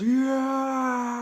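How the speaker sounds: high, loud whoop; triumphant yell. Retold or imitated. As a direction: cheer mid-backflip. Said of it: "and goes".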